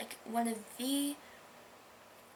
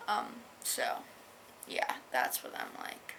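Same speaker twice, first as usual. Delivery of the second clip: whispered aside; 11 semitones lower, 6 dB quieter than usual. Real, not acted. Whispered aside after quote.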